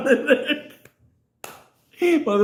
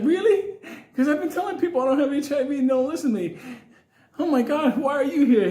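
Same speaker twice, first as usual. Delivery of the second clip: high, fast, giddy disbelief, laughing through it; mock amazed relief; 7 semitones higher, faster than usual. Cuffed prisoner meets fellow inmate, high giddy disbelief. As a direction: gush like he found a long-lost brother. Friend voiced, shocked he's not alone.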